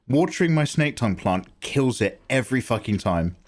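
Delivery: ordinary talk, nothing marked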